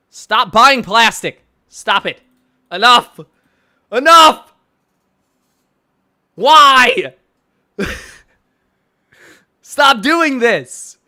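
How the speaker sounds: loud, high, separate outbursts; exasperated shouting. Real, not acted. Telling people to stop buying plastic, exasperated shouting. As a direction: shout each plea as its own burst. Pleading with toy collectors, yelling.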